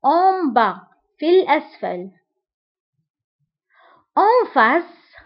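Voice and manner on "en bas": "en bas" ending on the open vowel, s not sounded; silent final s. Shown as a demonstration. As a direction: say "en bas" with no s at the end. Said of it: "نكتبها ب S في آخر الكلمة، ولكن لا ننطقها". (انطق "en bas" بدون نطق حرف S في الآخر)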